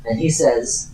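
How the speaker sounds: ordinary talk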